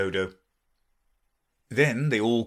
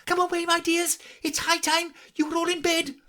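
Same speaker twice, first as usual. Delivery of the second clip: trembling canary. A narrator's reading. Canary calling its children home, trembling. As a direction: call your children away anxiously. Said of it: "in a trembling voice".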